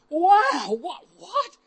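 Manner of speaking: high-pitched retold astonishment; amazed. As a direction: gasp it like a stunned onlooker. Retelling onlookers' amazement at dancing.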